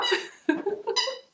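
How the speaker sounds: high, loud delighted laugh. Real, not acted. Owner amused by her happy dog, high laugh. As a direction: burst out laughing, high-pitched.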